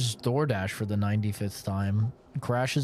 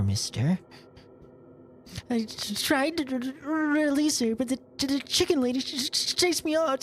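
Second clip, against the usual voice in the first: put-on higher, halting little boy voice with stammers; timid stuttering boy; 14 semitones higher than usual. Violin boy nervously telling the stranger about the pretty lady, higher and stammering. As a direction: stammer shyly like a nervous child.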